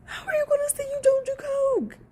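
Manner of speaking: high, stretched disbelieving squeal; incredulous squeal. Real, not acted. Vlogger squealing at Amber in disbelief, high incredulous squeal.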